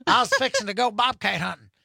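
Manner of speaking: put-on country boy gloating, pitched high; southern accent. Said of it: "I can do a southern accent".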